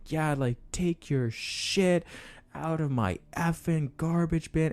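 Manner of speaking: slow, high angry-neighbor imitation; mock yelling. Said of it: "like, yelling at me".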